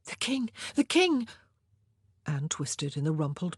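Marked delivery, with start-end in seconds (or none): 0.0-0.5 nervous warning. A narrator's reading. 0.8-1.3 higher, louder alarmed cry of The king; urgent warning. A narrator's reading. Women warning the queen the king comes, louder alarm.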